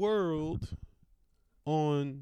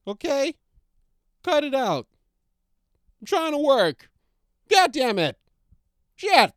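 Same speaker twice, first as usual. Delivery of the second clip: loud, high outburst; furious yelling; 10 semitones higher, 10 dB louder than usual. Real, not acted. Trying to work, yelling at the barking dog. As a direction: snap at the dog to cut it out.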